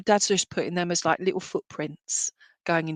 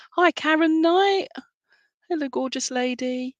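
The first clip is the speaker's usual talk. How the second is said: effusive greeting; 8 semitones higher, 3 dB louder than usual. Real, not acted. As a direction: greet them in a lifted, lingering sing-song. Greeting a viewer she knows.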